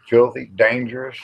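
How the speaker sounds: normal talking voice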